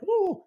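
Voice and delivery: high-pitched "ooh"; a sudden squeal of mock excitement, way up high; put-on high coo. Imitated noise: "it's not like.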